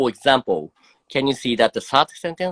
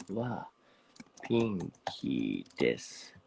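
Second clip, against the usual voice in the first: ordinary delivery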